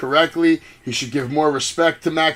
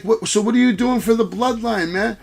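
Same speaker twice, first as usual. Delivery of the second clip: lightly raised, excited buddy voice; a hint of an overjoyed wrestler greeting his pal; hyped KO impression; 6 semitones higher than usual. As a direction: gush like an old friend hyping him up. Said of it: "He's like".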